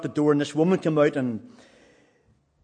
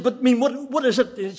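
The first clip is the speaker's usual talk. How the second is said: higher-pitched, flustered imitation; mild retold panic; 8 semitones higher than usual. Woman at the door questioning the preacher, mock mild panic. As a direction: act out someone almost panicking, stumbling on the words. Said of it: "almost like in a panic".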